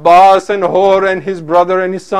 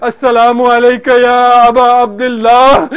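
anguished declaimed farewell; calling out; 4 semitones higher, 6 dB louder than usual. Retold or imitated. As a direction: call out a last greeting in lament. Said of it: "Hur fell down and called out".

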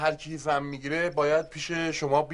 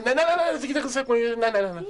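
protesting in panic; 8 semitones higher, faster than usual. Acted. Panics as they move to check.

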